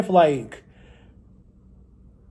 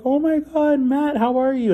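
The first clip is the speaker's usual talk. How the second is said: much higher, gushing hello; delighted greeting; 12 semitones higher than usual. Retold or imitated. Youth pastor's wife spotting him from the sidewalk, gushing high. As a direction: squeal it like spotting an old friend. Old friend spots him walking.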